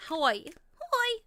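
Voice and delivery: high, loud squeaky greeting; squeaky toy. Real, not acted. Streamer who lost her voice says hi, squeaky. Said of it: "I sound like a damn squeaky toy".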